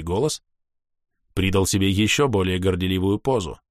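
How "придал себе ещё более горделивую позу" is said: plain reading voice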